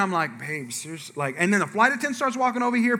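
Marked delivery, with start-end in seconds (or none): 0.4-1.1 soft, acted plea to the wife; quiet mock reproach; embarrassed aside. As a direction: say it under your breath to her.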